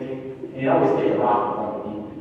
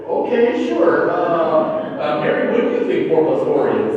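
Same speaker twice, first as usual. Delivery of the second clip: lightly acted teacher, louder, starting high; teacher caught off guard, louder; mock thrown teacher; 3 semitones higher, 7 dB louder than usual. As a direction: stall loudly on high notes, then move on to another pupil. Acts out teacher moving on, thrown.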